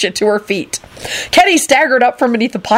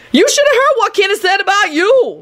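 high, sing-song smugness; self-satisfied high character voice; smug; 13 semitones higher than usual. A narrator's reading. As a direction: tattle smugly, pitched way up. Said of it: "Lucretia told Lupin smugly".

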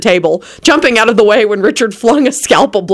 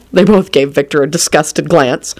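usual speaking voice

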